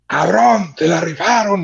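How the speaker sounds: high, loud shout from the front row; yelling at the drummer. Retold or imitated. Fan in the front row shouting at drummer, loud high yell. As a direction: yell it up to the stage for the drumstick. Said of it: "qué chingado le grité en español".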